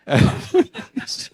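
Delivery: a breathy, high burst of laughter; high airy laugh. Real, not acted. Teller laughs at the story.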